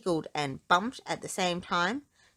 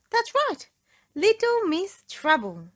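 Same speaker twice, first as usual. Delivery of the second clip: higher, louder, with a knowing lilt; excited reveal; 12 semitones higher, 7 dB louder than usual. A narrator's reading. Narrator to children, playfully unveiling the troublemaker. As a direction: announce her like a mischievous star.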